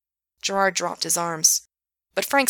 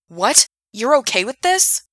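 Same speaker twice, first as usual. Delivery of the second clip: shocked disbelief; 6 semitones higher, 7 dB louder than usual. Acted. Gerard shocked at Frank.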